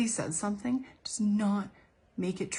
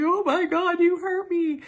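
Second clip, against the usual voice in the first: much higher, louder hammed-up hurt wail; mock wounded victim; 11 semitones higher, 8 dB louder, slower than usual. Mocking critics' victims.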